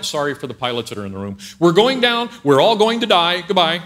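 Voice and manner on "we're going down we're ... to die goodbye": loud mock pilot giving doom news; pilot announcement. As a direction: call out the crash to the cabin. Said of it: "The pilot says".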